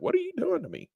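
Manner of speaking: high squeaky complaint from his own voice; voice complaining. Pretend. Teacher gives his tired voice a squeaky complaint. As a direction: squeak it as if your voice protests. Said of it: "My voice like".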